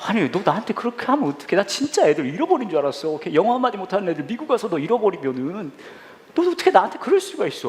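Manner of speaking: imitating mom's hurt protest without shouting; still-shaken mother. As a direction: reproach your son, rising in pitch toward the end. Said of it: "아직도 놀란 가슴이 진정이 안 되는".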